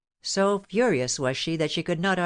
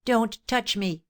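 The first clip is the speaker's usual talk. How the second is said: cried; 6 semitones higher, slower than usual. A narrator's reading. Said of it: "she cried".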